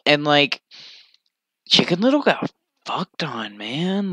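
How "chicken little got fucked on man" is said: breathy unvoiced whisper; hushed disbelieving aside. Real, not acted. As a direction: say it under your breath.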